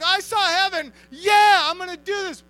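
high, loud, overjoyed whooping; Paul whooping with joy at seeing heaven; ecstatic whoa. Acted. Paul sees the prize.

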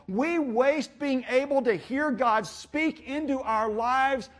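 slow, drawn-out, rising refrain; impassioned preaching. Real, not acted. Preacher lamenting bad decisions to congregation, impassioned slow preaching.

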